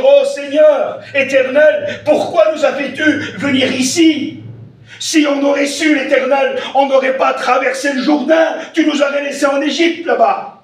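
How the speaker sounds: high, loud wailing complaint; complaining crowd. Retold or imitated. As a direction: cry out like a grumbling people. People complaining to God, voiced.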